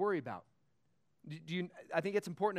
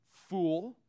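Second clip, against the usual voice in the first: 1 voice of God; 3 dB louder than usual. Retold or imitated. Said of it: "But God said to him".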